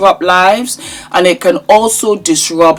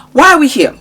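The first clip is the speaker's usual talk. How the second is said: high playful; 7 semitones higher, much faster than usual. Acted. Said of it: "repeats playful topic switch".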